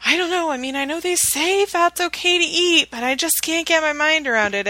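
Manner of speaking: high, unsure quoted voice, well above her own pitch; imitating her doubtful past self.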